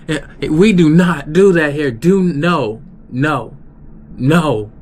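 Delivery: loud, high mock scolding; imitating an angry boss. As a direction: scold them like the heated coworker. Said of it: "he was mad".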